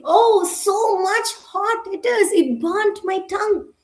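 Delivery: high-pitched pained complaint; crying out. A narrator's reading. Bird with a burnt tongue, crying out. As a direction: wail about the burn, storybook style. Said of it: "she cried".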